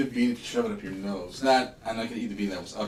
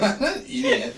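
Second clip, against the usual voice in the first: laughing; 8 dB louder than usual. Real, not acted. Refusing the bean, laughing.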